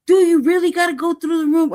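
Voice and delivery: real exasperation; indignant retort. Real, not acted. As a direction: throw the line back at them in disbelief.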